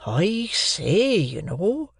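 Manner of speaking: a lightly voiced Joe, muttering; muttered aside as Joe. A narrator's reading. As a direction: mutter it under the breath as Joe. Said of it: "muttered Joe".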